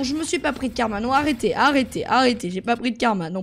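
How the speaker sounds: ordinary delivery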